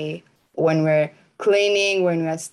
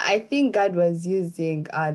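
usual speaking voice

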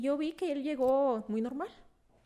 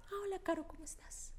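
whispered quote; 8 semitones higher, 11 dB quieter, faster than usual. Retold or imitated. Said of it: "pasaba mi amiga".

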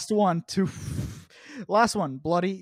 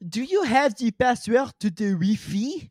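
hammed-up French accent, higher pitch, Wi-Fi as "wee-fee"; 5 semitones higher than usual. Mocking a French person asking for the Wi-Fi password, thick French accent. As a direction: play a bewildered French speaker asking in English. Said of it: "hear, like, a French person say Wi-Fi".

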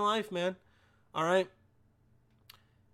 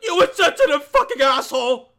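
much higher, much louder screaming insults; furious outburst; 10 semitones higher, 10 dB louder than usual. Retold or imitated. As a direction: shriek at him in outrage, half laughing. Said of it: "and be like".

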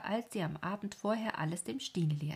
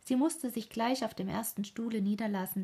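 ordinary delivery

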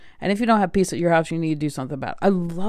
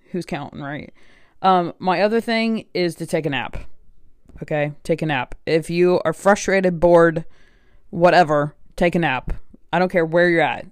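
normal talking voice

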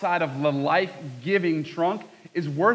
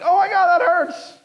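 much higher, louder hammed-up cry of pain; mock pained yell; 8 dB louder than usual. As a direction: ham up a branch yelling in pain. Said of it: "It's not like".

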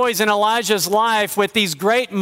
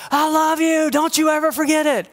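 higher, calling across the house; mock shout; 9 semitones higher than usual. Grandmother calling love across the house as they leave. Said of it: "could she have shouted".

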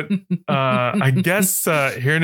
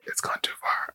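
much softer, whispered aside; hushed admission; 10 dB quieter than usual. Real, not acted. Host giving up on an accent gone wrong, whispered. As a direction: whisper it, abandoning the bit. Breaking off a failed accent bit.

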